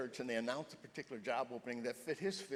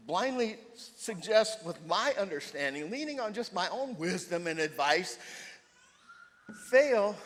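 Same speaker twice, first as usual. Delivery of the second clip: rising, louder and higher, peaking on fail; building preaching; 6 semitones higher, 9 dB louder, slower than usual. Acted. Preacher to the congregation, building to a loud, high cry. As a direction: preach it harder and harder until the final word bursts out.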